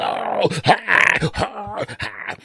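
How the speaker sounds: hammed-up effort cries, fading out; straining groans. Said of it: "I do have to make the noises".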